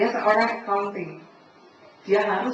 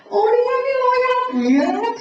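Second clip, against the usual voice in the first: loud, slow, much higher mock praise; mock admiration; 12 semitones higher, 9 dB louder, slower than usual. Preacher mocking devotion to work before the congregation, sarcastic gush. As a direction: gush sarcastically over the loyalty.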